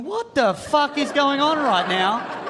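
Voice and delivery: slow, high incredulous question; baffled disbelief. Retold or imitated. Boyfriend baffled by the girls' sizes chat, slow disbelief. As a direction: drag it out in disbelief. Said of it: "I was just looking at him going".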